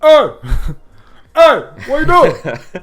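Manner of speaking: high, loud, drawn-out mock voice; imitated big stranger voice. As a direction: call it out in an exaggerated put-on voice. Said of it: "you got that voice though".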